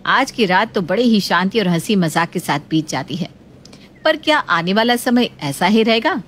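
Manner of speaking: usual speaking voice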